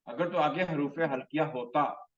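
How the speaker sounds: ordinary talk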